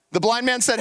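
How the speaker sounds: ordinary talk, nothing marked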